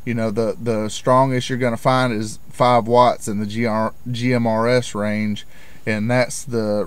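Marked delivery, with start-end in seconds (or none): none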